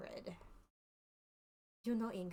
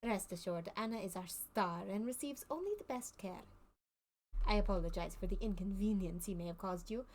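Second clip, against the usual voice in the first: put-on lightly French-accented circus showman. Circus man to the player, explaining his put-on accent in light French accent. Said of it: "I can do French".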